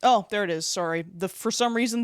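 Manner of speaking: ordinary talk, nothing marked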